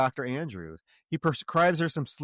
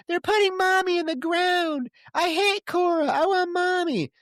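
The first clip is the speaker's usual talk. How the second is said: put-on much higher and louder, a distressed child; a grieving child crying for her mother; upset little girl; 4 dB louder than usual. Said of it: "now she's talking like a little girl".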